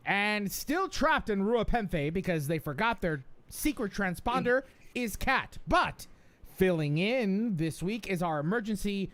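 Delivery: high, lively announcer read; lifted, teasing build to the guest; podcast host introduction. Real, not acted. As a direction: introduce the hosts with a flourish. Reads the show's intro.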